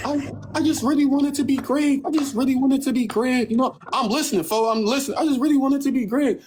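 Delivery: high, whiny imitation of her; mocking Elise. Retelling Elise's words to friends, high mocking voice. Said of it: "Oh, bro, she like".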